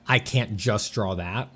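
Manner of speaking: normal talking voice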